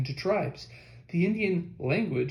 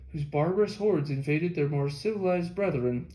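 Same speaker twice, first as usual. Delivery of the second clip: ordinary delivery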